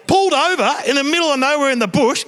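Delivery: normal talking voice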